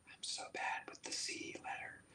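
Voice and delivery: breathy whispered aside to the listener. Real, not acted. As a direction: drop to a whisper for a quick aside. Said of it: "whispered aside".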